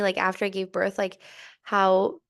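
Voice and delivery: normal talking voice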